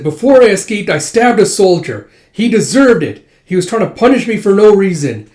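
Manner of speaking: high, loud manic confession; manic defiance. Acted. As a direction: shout it out wild-eyed.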